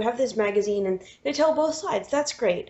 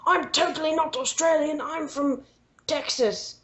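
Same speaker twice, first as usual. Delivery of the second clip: mock bad Australian; 5 semitones higher than usual. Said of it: "the actor was like".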